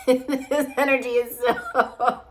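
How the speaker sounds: helpless giggles breaking in; real laughter. Real, not acted. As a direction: crack up as you try to talk. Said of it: "It's actually kind of making me laugh".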